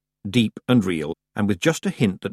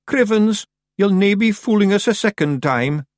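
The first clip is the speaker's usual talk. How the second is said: high, loud Scots creature voice; indignant Scottish Feegle; 12 semitones higher, 5 dB louder than usual. A narrator's reading. Feegle defying Tiffany from under the bed, high loud Scots. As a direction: crow defiantly as a little Scottish Feegle.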